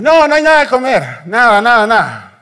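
high, loud shouted refusal, dropping at the end; acted long-distance shout; calling back. Juan calls back to the shore.

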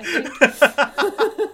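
bursting into laughter; laughing. Real, not acted. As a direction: laugh through the words. Laughing at own joke.

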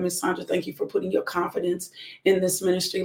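usual speaking voice

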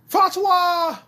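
high, loud, held cry; excited shout of the title; shouted catchphrase. Pretend. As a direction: belt the word out.

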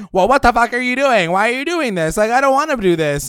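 loud, high-pitched outraged rant; high, ranting mock outburst; losing it. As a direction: imitate her blowing up at him. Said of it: "loses her shit on him".

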